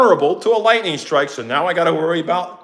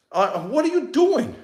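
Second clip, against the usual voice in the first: high, incredulous squeak; 7 semitones higher than usual. Retold or imitated. Catching the crew on camera, high disbelief. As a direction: throw it up in disbelief. Reacting to ruined cable.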